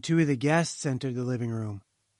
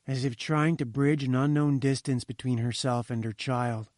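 usual speaking voice

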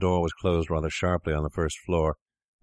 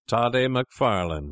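slow, higher-pitched announcing of a name; herald-like hail; 5 semitones higher than usual. A narrator's reading. Fettes repeating his hail to the doctor, herald-like. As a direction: proclaim it slowly, like a herald. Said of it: "more like a herald than a friend".

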